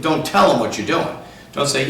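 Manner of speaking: usual speaking voice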